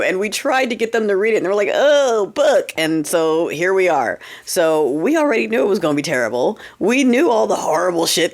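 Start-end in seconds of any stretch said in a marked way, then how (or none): 1.7-2.7 mocking dimwit. Mocking voters who won't read.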